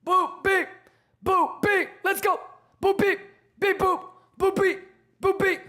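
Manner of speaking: very high beeping clown chant; silly clown. Acted. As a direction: beep like a toy clown.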